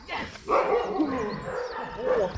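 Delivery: high, drawn-out dog praise. Shown as a demonstration. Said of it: "more vocal inflection".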